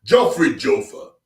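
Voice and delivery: a hint of a pompous king; King Joffrey voice. Shown as a demonstration. Streamer showing the King Joffrey voice for chat, regal. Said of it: "Did you see the difference?".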